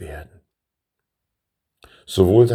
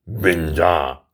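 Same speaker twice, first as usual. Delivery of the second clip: growled. A narrator's reading. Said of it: "knurrte er".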